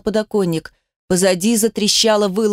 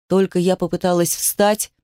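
usual speaking voice

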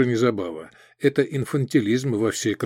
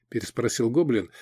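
ordinary delivery

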